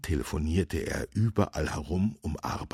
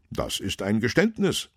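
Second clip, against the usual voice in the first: higher, louder official declaration; lightly voiced officer making a finding; policeman character; 7 semitones higher, 5 dB louder than usual. A narrator's reading. Policeman answers the surrendering robber, lightly voiced and firm.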